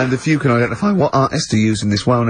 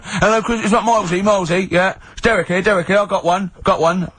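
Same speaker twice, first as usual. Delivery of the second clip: eager caller impression; 7 semitones higher than usual. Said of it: "wanted to get through and go".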